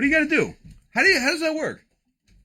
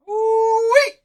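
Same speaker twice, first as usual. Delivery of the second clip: much higher, louder whooping cry; ooh-wee whoop; 7 dB louder than usual. Real, not acted. Host thrilled by a Mike Trout card, whooping. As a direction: let out a giddy whoop.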